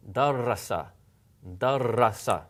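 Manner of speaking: Darasa with a long, doubled r in the middle. Shown as a demonstration. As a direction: hold the r in Darasa for two beats. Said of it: "pronounce two of them in a row".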